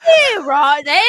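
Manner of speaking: loud, exaggerated British accent. Playing up to a British accent fan, British accent. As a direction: belt out British phrases in a put-on accent. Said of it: "My kink is British accents".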